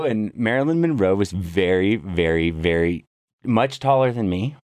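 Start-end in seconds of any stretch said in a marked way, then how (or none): none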